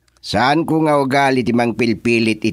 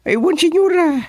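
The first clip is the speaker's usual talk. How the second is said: eager agreement. Acted. Aide excitedly agreeing with the senyora, high and eager.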